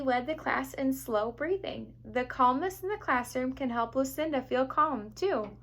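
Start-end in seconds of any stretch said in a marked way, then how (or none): none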